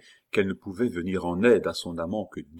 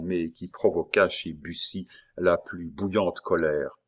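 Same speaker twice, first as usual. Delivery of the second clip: usual speaking voice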